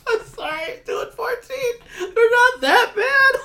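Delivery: high, loud crying laughter; laughing to tears. Real, not acted. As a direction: laugh till you cry. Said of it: "I'm crying".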